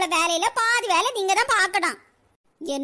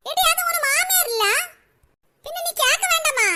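high-pitched, indignant reproach; scolding; 6 semitones higher than usual. Acted. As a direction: scold her for forgetting her manners. Acted scolding over greeting mother-in-law.

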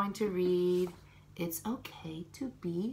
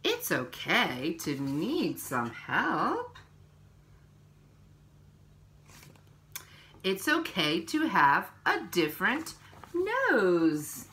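slow, gentle picture-book reading; reading to children; 3 semitones lower, 3 dB louder, slower than usual. Acted. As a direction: read each page to little ones, unhurried.

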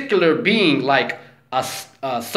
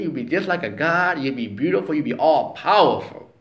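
ordinary delivery

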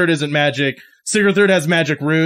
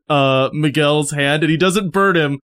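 ordinary talk

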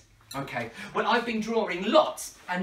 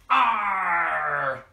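loud pirate arr; pirate cry on the count of three; pirate shout; 5 dB louder than usual. Shown as a demonstration. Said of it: "say the pirate magic words".

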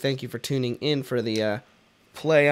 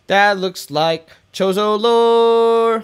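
higher, louder, slow delight on "lore"; excited drawn-out discovery; 7 semitones higher, 7 dB louder, much slower than usual. Real, not acted. Gamer spotting ancient lore, slow, loud, thrilled. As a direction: stretch it out like finding treasure.

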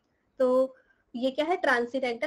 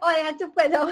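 laughing outburst; 3 semitones higher, 7 dB louder than usual. Real, not acted. Teacher hushing the class mid-story, laughing. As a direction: burst out laughing while telling them to shut up.

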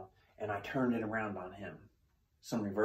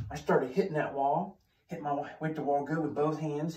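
ordinary talk

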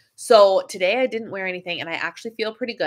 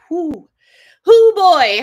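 loud, high exclamation; 8 semitones higher, 12 dB louder, much slower than usual. Real, not acted. A burst of excitement about the course content. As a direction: let it burst out, overwhelmed. Overwhelmed by the upcoming topic.

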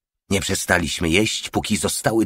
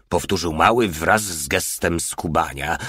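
usual speaking voice

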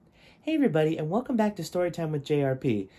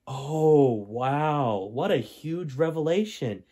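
mock amazement; much slower than usual. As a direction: play up the shock for the viewers. Host reacts to story twist.

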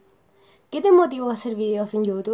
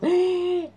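mock shocked gasp; 10 semitones higher, 4 dB louder than usual. Acting her dead-battery reaction.

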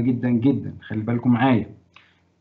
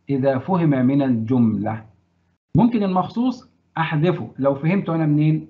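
normal talking voice

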